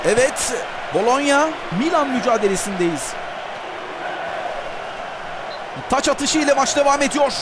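live play-by-play. Real, not acted. Live match commentary.

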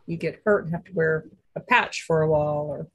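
ordinary delivery

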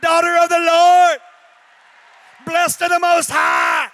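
hammed-up pastor blessing shout; grand pastor blessing. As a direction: bless her in full preacher pomp. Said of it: "I said".

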